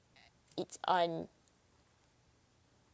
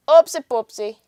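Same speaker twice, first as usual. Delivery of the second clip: higher, punchy and out loud; loud playful oops; 4 semitones higher, 11 dB louder than usual. Real, not acted. Streamer fumbling with the audio, loud playful oops. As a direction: sing out the slip cheerfully. Repeats the whispered oops aloud.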